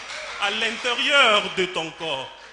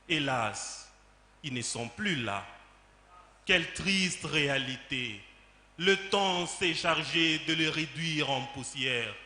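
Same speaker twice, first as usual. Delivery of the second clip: soft, low, sorrowful recitation; mournful lament; 4 semitones lower, 6 dB quieter than usual. Acted. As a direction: drop down into quiet grief. Poem laments the lost parents. (lamentation triste, à voix basse)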